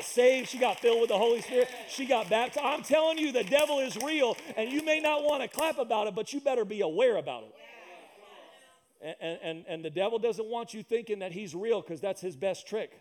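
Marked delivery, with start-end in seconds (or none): none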